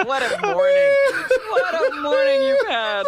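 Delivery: high, laugh-filled exclamation; real laughter through the words. Real, not acted. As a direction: say it while cracking up. Co-host laughing at the story.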